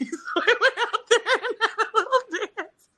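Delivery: high laughing telling; high laugh about finally dancing. Real, not acted. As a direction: giggle through the line.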